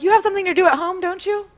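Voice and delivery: very high, pointed hint to leave; hint sweetly that it's time to go; mock polite brush-off. Retold dismissal of the morning-after date.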